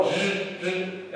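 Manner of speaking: soft buzzing zzz hissed through the teeth; buzzing zz sound. Shown as a demonstration. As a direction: hum a quiet buzzing z. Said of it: "este son que suena". (zumbido suave de zeta)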